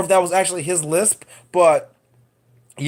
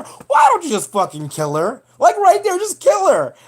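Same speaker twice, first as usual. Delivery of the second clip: exasperated outburst; 10 semitones higher than usual. Real, not acted. Fed up with the killer, yelling that he should just act. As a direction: yell at the screen in disbelief.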